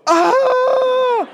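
long, very high-pitched loud scream; high mock scream. Group reacting to the fake killer, hammed-up high scream. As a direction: scream in exaggerated terror. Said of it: "And we all go".

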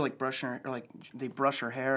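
ordinary talk, nothing marked

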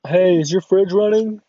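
high, loud mock prank-call voice; loud, put-on caller with a silly setup. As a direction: pipe up like a cheesy prank caller. Acting out a prank-call gag.

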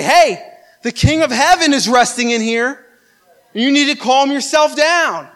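commanding rebuke. Acted. Preacher's spirit to the troubled mind, commanding rebuke. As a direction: call out sharply, then give a firm order.

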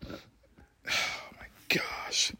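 slow breathless whisper; drained, barely voiced gasp of despair; exhausted whisper. Real, not acted. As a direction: let it out on a whisper, spent. Deflated after the rant.

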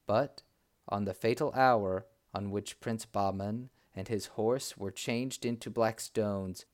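plain reading voice

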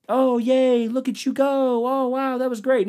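high, gushing cheer; sugary mock praise; mock cheering parent. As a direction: coo over a child. Mocking adults cheering a kid.

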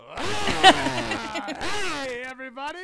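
put-on high, far-off drunk uncle greeting; loud sloppy drunk uncle hello. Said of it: "just going".

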